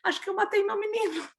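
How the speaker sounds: high-pitched imitation of a tearful confession; retold tearful call. Mother phoning the host family about her baby, mock-tearful. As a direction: re-enact a panicked, weepy phone call. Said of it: "chorando, eu falei assim".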